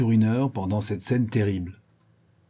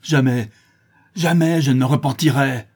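loud, higher defiant cry; enraged; 5 semitones higher, 6 dB louder than usual. Acted. Schoolmaster refusing to repent, enraged. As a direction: refuse furiously. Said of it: "avec rage".

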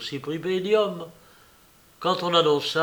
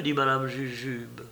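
plain reading voice

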